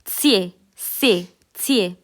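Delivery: "त्से" with a clear ts at the start, said slowly; German Z sound. Shown as a demonstration. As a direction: draw out the ts in "त्से". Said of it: "ये ऐसे बोलता है". ("त्से" में साफ़ त्स की आवाज़, धीरे)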